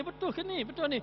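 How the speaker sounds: high, disbelieving check; incredulous question. Acted. Ibrahim asking God if it's really so, high and incredulous. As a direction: ask as if you can't believe it.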